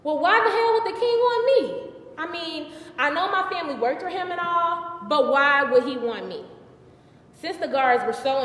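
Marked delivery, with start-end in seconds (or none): 0.0-6.5 high, loud baffled questioning; incredulous. Acted. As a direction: question it in theatrical disbelief. Baffled at king's summons.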